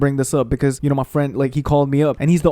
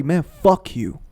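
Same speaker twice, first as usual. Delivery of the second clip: ordinary delivery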